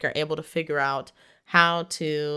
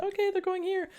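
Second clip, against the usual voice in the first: mock squeaky surrender; 4 dB quieter than usual. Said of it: "And he's like".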